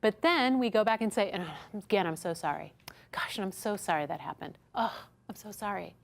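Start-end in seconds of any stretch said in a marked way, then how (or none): 1.3-5.9 hushed, repeated I'm so sorry; over-apologizing whisper. Shown as a demonstration. Trainer mocking staff who over-apologize to a guest, whispered. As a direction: keep apologizing in a fading whisper. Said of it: "Don't apologize anymore".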